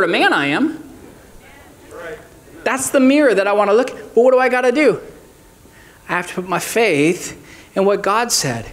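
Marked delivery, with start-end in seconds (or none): none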